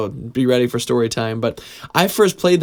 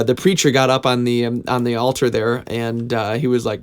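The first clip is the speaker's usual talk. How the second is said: ordinary talk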